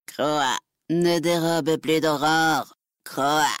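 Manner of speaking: slow croaking crow voice, lightly done; a hint of a cawing raven. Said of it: "qui lui croassa aux oreilles".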